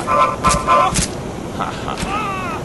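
high mock villain cackle; short hammed-up evil laugh.

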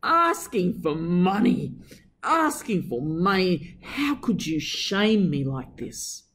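slow, despairing lament; a slow hurt wail; moaning mother. A narrator's reading.

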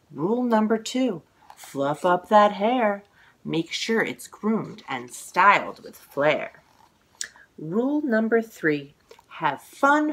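lively, sing-song picture book reading; reading to children. A narrator's reading. Author reading her book aloud to children, sing-song. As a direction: read the rhymes with bounce for little listeners.

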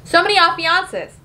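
high, giddy exclamation; mock excitement. Narrator gushing over all the engagements, giddy. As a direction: squeal with playful delight.

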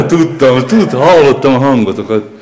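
loud, gleeful I-knew-it; triumphant, almost laughing; caught you. Real, not acted. Finding the kids hiding inside, loud gleeful call.

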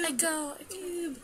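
soft, dwindling echo imitation; fading echo. Player calling Ib, voice fading like an echo. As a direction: let each repeat die away.

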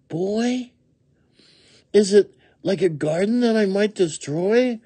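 very high questioning woodchuck voice; puzzled woodchuck. A narrator's reading. Woodchuck figure in story.

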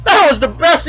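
high, crowing mock glee; imitated hysterical amusement. Bet winner to the man who peed, acted hilarity. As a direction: shout it as a tickled, gloating winner. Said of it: "laughing like a hyena".

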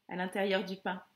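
ordinary talk

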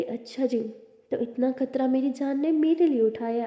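ordinary delivery

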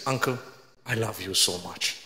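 almost a whisper of affection; tender. Retold or imitated. Preacher softly telling the angry uncle he loves him, tender and hushed.

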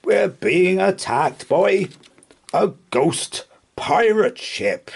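high, drawn-out cry of alarm; quivering yell. A narrator's reading. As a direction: sound the alarm as the terrified skipper. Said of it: "yelled Craddock with quivering lip".

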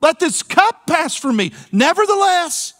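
anguished prayer. Retold or imitated. Preacher voicing Jesus praying in the garden, high and anguished. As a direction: plead upward in anguish. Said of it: "Jesus in the Garden of Gethsemane".

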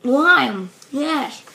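loud happy yes; excited. Real, not acted. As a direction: celebrate the good flavor. Relieved to get a good bean.

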